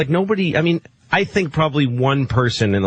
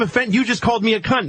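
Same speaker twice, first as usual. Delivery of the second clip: high mocking woman's voice; mocking offended woman; 7 semitones higher than usual. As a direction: mimic her offended whine, pitched up. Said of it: "Like she's like".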